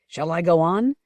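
ordinary delivery

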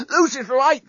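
breathless, high-pitched gasp; winded high cry; panting. A narrator's reading. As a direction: pant it out mid-fight. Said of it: "the journalist panted".

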